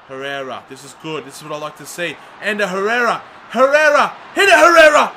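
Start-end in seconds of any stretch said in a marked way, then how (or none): 2.4-5.1 rising from urging to a high, much louder shout; building excited yell. Real, not acted. Gamer urging his midfielder to shoot, building to a yell. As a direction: ramp up, begging him to shoot.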